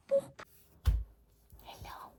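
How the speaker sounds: barely-there whisper, no voice; whispered to dog. Real, not acted. Owner whispering to her sleeping dog, hushed whisper. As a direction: breathe it so the dog stays asleep.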